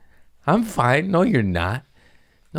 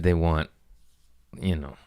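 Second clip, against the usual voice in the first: ordinary talk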